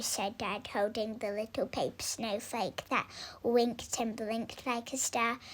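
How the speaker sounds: ordinary delivery, nothing marked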